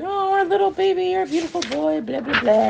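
put-on very high-pitched gushing baby talk, falling toward the end; mock cooing. Two men fawning over Henry, high mock coo.

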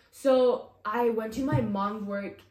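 usual speaking voice

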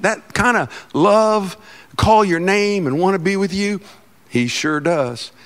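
ordinary delivery

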